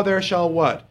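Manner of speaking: ordinary delivery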